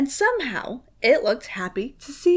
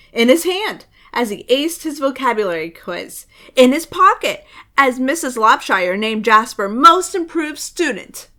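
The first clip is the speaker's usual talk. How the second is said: ordinary delivery, nothing marked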